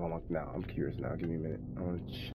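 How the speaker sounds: muttering. Real, not acted. Muttering to self.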